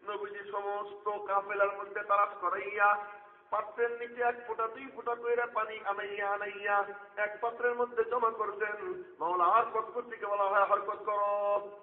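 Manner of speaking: loud, high, slowed preacher's cry; preaching at full force. Acted. Preacher calling out to the gathering in a loud, high cry.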